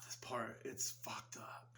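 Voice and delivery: hushed, ominous whisper; slow secretive whisper. Real, not acted. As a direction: drop to a whisper for the grim part. Telling the dark scene.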